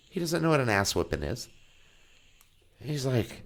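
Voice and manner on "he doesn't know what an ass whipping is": ordinary talk, nothing marked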